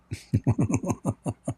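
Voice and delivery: quiet breathy chuckle; soft laugh. Real, not acted. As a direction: laugh it off softly. Laughs at his own impression.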